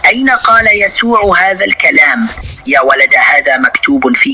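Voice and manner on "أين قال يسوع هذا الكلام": mocking quote. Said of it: "هذا النصراني يقول".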